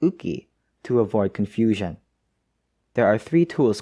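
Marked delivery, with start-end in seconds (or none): 0.0-0.5 "uki" said OO-kee; oo-kee nickname. Shown as a demonstration. Narrator giving the nickname, "uki" pronounced oo-kee. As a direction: say "uki" as oo-kee, two syllables. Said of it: "to use its nickname".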